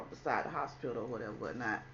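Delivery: usual speaking voice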